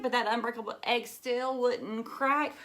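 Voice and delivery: put-on lively read-aloud for children; storybook reading. A narrator's reading. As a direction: read it like a picture book to a class. Reads attack lines loudly.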